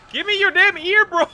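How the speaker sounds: high, loud frustrated yell; angry shout. Real, not acted. Demanding the ear from the monster, angry shout. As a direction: scream at the monster.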